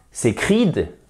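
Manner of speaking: "sacred" dit sé-KRID, appui sur la fin; prononciation fautive. Shown as a demonstration. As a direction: imite un francophone qui dit "sacred" sé-krid. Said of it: "on ne dira pas". ("sacred" à la française, deuxième syllabe en "krid")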